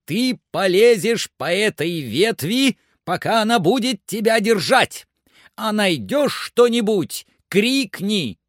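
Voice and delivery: high, loud, urgent orders; frantic excited shouting. A narrator's reading. Master orders climber along the branch, frantic excited shouting. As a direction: push each order out at a high pitch. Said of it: "закричал Легран вне себя от волнения".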